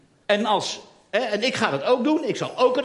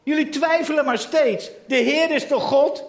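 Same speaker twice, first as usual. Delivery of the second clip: raised, high voice pressing the crowd; challenging prophet; 7 semitones higher, 5 dB louder than usual. Acted. Elia roept het twijfelende volk toe, luid en hoog. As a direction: demand an answer from the doubters.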